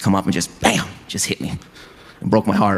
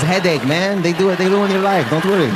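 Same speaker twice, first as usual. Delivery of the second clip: high, lightly accented dad imitation; mock fatherly advice, pitched up; dad's voice; 9 semitones higher, 3 dB louder than usual. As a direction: play the dad warning his son off girls. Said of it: "He goes".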